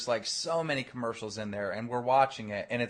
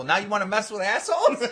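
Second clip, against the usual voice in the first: loud, higher, breaking into laughter; cracking up at punchline; 7 semitones higher, 6 dB louder than usual. Retold or imitated. Teller mocks the victim's word choice, bursting into laughter. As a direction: deliver the punchline as laughter takes over.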